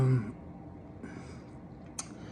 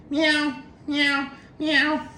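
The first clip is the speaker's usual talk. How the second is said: high mock cat meows; imitated meows; 13 semitones higher, much slower than usual. A cat staring at nothing on the wall, mewing high.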